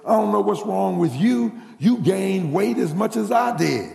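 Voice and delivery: she's insulted, fire it back; mad retort. Retold or imitated. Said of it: "her friend got mad and said".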